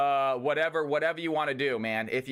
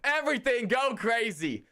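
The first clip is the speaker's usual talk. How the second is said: high, slow hype chant; hyped shouting; 5 semitones higher than usual. Real, not acted. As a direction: chant it for the crowd. Dancing hype in chat.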